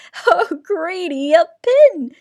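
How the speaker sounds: high, loud spluttering laugh; spluttering giggle. A narrator's reading. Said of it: "spluttered Bruce with a giggle".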